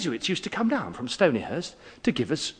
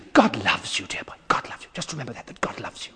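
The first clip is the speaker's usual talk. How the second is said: whispered reassurance; 5 semitones lower, faster than usual. Retold or imitated. Teacher whispering reassurance to the weeping boy. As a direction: breathe the words gently to a child. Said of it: "He said".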